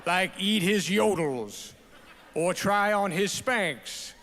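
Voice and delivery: hammed-up Southern speechifying; goofy Southern accent. Dad reads his daughters' speech to the awards crowd, goofy Southern accent. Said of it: "goofy Southern accent you always do".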